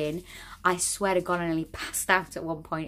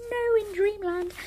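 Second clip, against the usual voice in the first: mock dreamy voice; slower than usual. Mocking her own fantasy.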